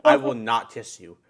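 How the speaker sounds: ordinary delivery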